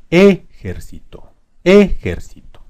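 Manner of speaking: ejército as E-jercito, E high, loud and long; stress on first E. Shown as a demonstration. Teacher moving the stress to E, slow high E in ejército. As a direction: punch the first E of ejército, slowly. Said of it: "ponerle la sílaba tónica en la E".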